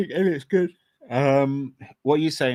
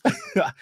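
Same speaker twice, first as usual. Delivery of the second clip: high laugh. Real, not acted. Bursts out laughing.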